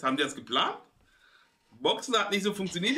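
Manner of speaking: ordinary talk, nothing marked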